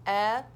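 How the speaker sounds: "Elephant" called out loud in three beats; teacher leading a class chant; syllable-by-syllable. Shown as a demonstration. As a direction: sound out "Elephant" in three parts. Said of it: "say it with me".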